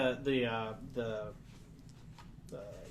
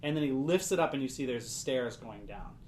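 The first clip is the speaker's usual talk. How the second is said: ordinary talk, nothing marked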